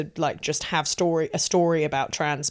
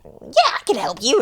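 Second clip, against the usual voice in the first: eager helper voice; 4 semitones higher than usual. Retold or imitated. Acting out her eager helpful self.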